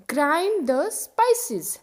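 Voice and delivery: grind the spices said very slowly; slow model sentence. Shown as a demonstration. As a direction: spell out each word slowly for learners. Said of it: "example देखो". (धीरे-धीरे, साफ़ बोलकर)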